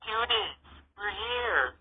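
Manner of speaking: high, slow, stretched rocker greeting; rock-star sister drawls her big entrance. Acted. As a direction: drag it out like a rock star on stage. Rocker sister announces arrival.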